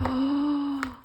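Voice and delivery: sudden awed gasp; delighted gasp. Real, not acted. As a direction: catch your breath in delight. Gasping at a finished craft.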